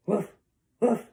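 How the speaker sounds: two short, separated woofs; dog woof. Acted. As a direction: imitate a dog with two abrupt barks. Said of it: "A dog says".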